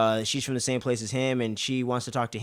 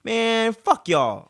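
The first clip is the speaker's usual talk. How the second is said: sharp, louder retold outburst, high on Man; pissed-off exit; 10 semitones higher, 5 dB louder, much slower than usual. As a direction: storm off mid-sentence, half-mocking. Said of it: "walks off all pissed like".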